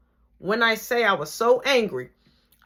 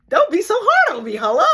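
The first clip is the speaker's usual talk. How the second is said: laughing shout; 14 semitones higher, 9 dB louder than usual. Retold or imitated. Pleading shout at opponent.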